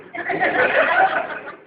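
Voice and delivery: risita aguda y soplada; risa aguda. Acted. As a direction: suelta una risita muy aguda. (risa aguda sin voz)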